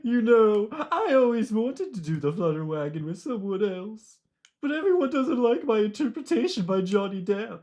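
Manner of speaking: high-pitched, swooping eccentric voice; Mad Hatter. Acted. Hatter laments nobody likes his version, zany. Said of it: "with the actual Mad Hatter voice".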